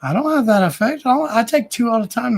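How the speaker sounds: high-pitched, baffled self-defence; mock protest. Retelling own baffled reply to friend.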